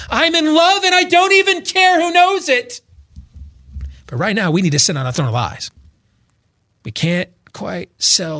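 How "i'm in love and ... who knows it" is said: put-on much higher, louder, giddy man-child bursting with joy; Will Ferrell as Elf. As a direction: shout it out like a joyful overgrown elf. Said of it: "we'll be like Will Ferrell and Elf".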